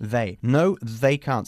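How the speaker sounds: "they" with the tongue between the teeth. Shown as a demonstration. Teacher corrects the student's "they" by showing the TH. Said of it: "Sacando la lengua".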